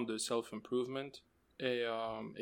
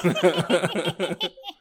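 witch cackle; 5 semitones higher, 7 dB louder than usual. Acted. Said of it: "C'est mon rire de sorcière".

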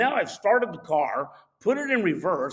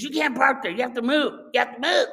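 insistent, higher-pitched mock yell; retold screaming; 6 semitones higher, 3 dB louder than usual. Lady at the window to the driver, retold screaming. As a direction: imitate her ordering you off, high-pitched. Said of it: "she's screaming at the top of her lungs".

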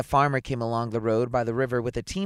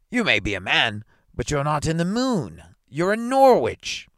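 emphatic contradiction; 3 semitones higher, 6 dB louder than usual. Acted. Magistrate setting the prisoner straight, emphatic. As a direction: correct him firmly, stretching the last words.

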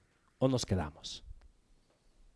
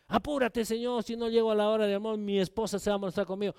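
fast, mock-fretful rush; impatient pleading; 9 dB quieter, faster than usual. Abraham worried about his wife, rushed and fretful. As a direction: hurry the words out anxiously.

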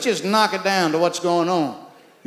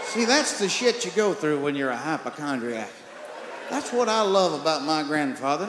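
ordinary delivery

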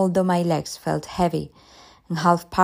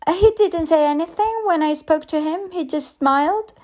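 put-on much higher, chirpy young teacher; cheerful and chatty, unaware of anything wrong; teacher gushing brightly to the mother; 9 semitones higher, 4 dB louder than usual.

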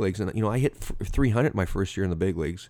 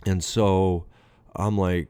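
ordinary delivery

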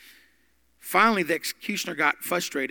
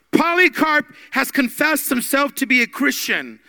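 much higher and louder proclamation; crying out to crowd; 9 semitones higher, 8 dB louder than usual. Retold or imitated. Angry ruler calling to the crowd, loud. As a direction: announce it to the whole arena. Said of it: "the ruler cried out to the crowd".

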